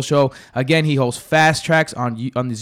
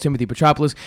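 ordinary talk, nothing marked

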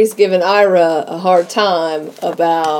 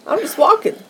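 mock innocence; 11 semitones higher, 3 dB quieter than usual. Retold mock-innocent denial.

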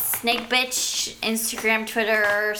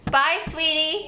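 sweet goodbye; 5 semitones higher than usual. Real, not acted. Slow, high farewell to toddler.

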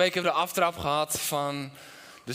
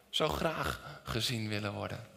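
ordinary talk

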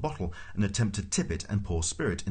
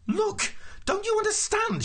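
very high, frantic outburst; exasperated shout; 3 dB louder than usual. A narrator's reading. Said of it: "shouted Arthur".

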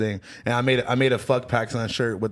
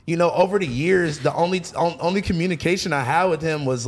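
ordinary delivery

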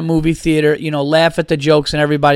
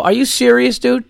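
incredulous; 7 semitones higher than usual. Retold or imitated. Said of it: "And I go".

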